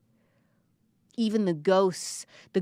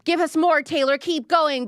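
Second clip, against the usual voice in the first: crowd shouting; 5 semitones higher, 5 dB louder than usual. Retold or imitated. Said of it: "as the crowd was shouting".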